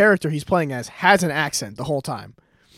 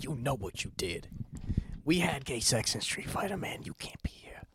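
very soft, tense mock argument; hushed accusation; 2 semitones lower, 14 dB quieter than usual. Host confronting his secret partner at dinner, hushed accusation. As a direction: accuse under your breath, nearly whispering.

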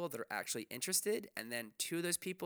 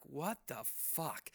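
incredulous; 5 dB quieter, much slower than usual. Retold or imitated. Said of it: "I'm like".

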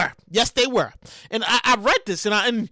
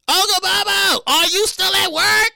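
put-on kid texting voice; 7 semitones higher, 3 dB louder than usual. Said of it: "He texts me".

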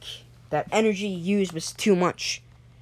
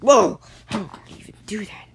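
high "whoa" dropping to breathy awe; amazed; 3 semitones higher, 4 dB louder than usual. Acted. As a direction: gasp out in astonishment at the feat. Onlookers stunned by flying crash.

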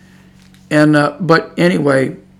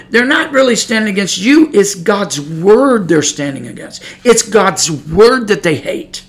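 full-force preaching; 4 semitones higher, 4 dB louder than usual. Acted. Preacher warning the congregation, rising fervent preaching. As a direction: drive it home from the pulpit.

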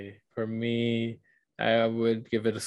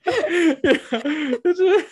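a sudden high burst of laughter; 5 dB louder than usual. Real, not acted. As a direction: laugh out loud, caught off guard. Friend's dismissal of the abuse.

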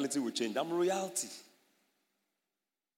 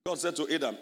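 ordinary talk

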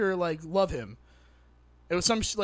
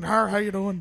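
imitating Jodie Foster. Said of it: "Is that what she sounds like?".

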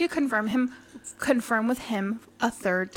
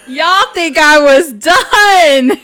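loud, slow, higher holler; hammed-up, stretched-out twist reveal; dramatic repeat; 4 semitones higher, 12 dB louder, much slower than usual. Real, not acted. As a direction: drag out DONE at full volume. Repeats tease, dragged out and bigger.

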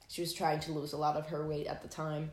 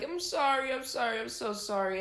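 higher-pitched, pitiful mock apology; small, sad apology; 5 semitones higher than usual. Boyfriend apologizing mid-argument, small sad imitation. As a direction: imitate a hurt puppy apologizing. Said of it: "apologizing and making himself, like, very small and sad".

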